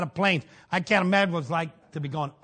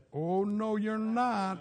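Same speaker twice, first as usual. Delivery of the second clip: soft, calm quiet answer; a hushed, confident rebuttal; quiet defiance; 2 semitones higher, 7 dB quieter, much slower than usual. Retold or imitated. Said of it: "and I said".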